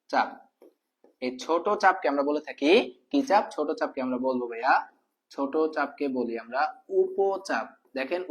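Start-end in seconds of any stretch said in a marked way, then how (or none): 5.3-7.7 slow, careful teacher delivery ending on উপচাপ; deliberate slow classroom pronunciation; slow demonstration. Shown as a demonstration. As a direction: spell out উপচাপ slowly for the class. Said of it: "উচ্চারণ করেন ভাইয়া".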